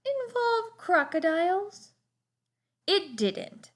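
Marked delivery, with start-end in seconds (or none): 0.0-1.9 high, lightly voiced old lady; a hint of a curious aunt; Cousin Clara. A narrator's reading. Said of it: "asked Cousin Clara".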